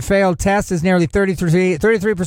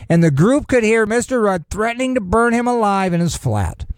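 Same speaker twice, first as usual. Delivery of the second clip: ordinary delivery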